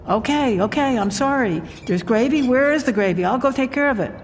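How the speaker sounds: high-pitched, apologetic fluster; flustered wife. Acted. Wife answering her scolding husband at dinner, high and flustered.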